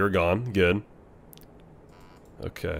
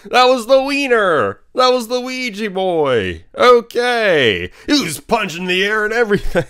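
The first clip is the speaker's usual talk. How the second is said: excited exclaiming; 9 semitones higher than usual. Real, not acted. Gamer recounting the jumpscare to viewers, high giddy outburst. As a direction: tell it still buzzing from the scare.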